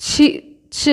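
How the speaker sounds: ছি, ছ as short, separate aspirated syllables; demonstrated chh. Shown as a demonstration. Teacher showing learners the letter's sound, demonstrated chh. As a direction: sound out ছি, ছ with a puff of air. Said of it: "এরম একটা উচ্চারণ হচ্ছে".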